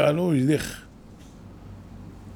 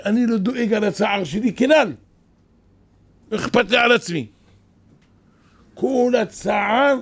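emotional quote; 7 semitones higher, 4 dB louder than usual. Retold or imitated. Rabbi quoted with rising emotion.

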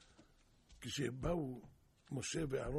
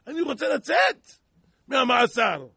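loud, high cry to get out; desperate shout; 8 semitones higher, 19 dB louder than usual. Retold or imitated. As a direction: yell the plea with all force. Prisoner pleads to be freed.

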